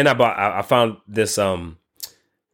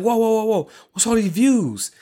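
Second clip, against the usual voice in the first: much higher, stunned whoa whoa whoa; astonished whoa; 12 semitones higher than usual. Retold or imitated. Seeing two thousand views on his channel, astonished and much higher. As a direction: gasp it out like you can't believe the numbers. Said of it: "I said".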